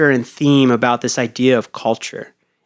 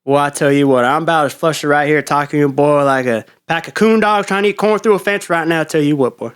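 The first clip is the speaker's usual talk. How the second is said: Texas accent. Shown as a demonstration. Player posing an accent for cohost to guess, hammed-up Texas Southern. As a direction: lay on a hammed-up Texas twang. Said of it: "wait, yours is Texas?".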